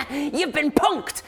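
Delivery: very high, loud, drawn-out prankster's cry; high, cackling gotcha yell; gleeful shout. Acted. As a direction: shout it slowly like a triumphant prankster. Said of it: "he shouts".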